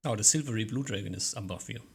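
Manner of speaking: usual speaking voice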